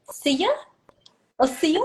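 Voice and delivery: put-on "see ya" for silla in an English accent; questioning, English-accented "see ya". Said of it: "do it in English accent".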